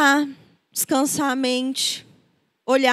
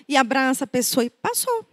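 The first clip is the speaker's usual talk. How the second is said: usual speaking voice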